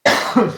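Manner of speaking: harsh cough, choking on food. Real, not acted. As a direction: cough hard, something went down wrong. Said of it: "Sorry, I'm fucking choking here".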